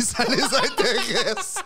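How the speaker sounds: high, slow words through real laughter; cracking up mid-sentence; helpless giggle stretching the words out; laughing. Real, not acted. Cracks up while speaking.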